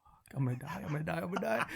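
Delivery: hushed, frantic aside to himself; panicked aside. Retold or imitated. Prank caller privately dreading he'll burst, panicked and hushed.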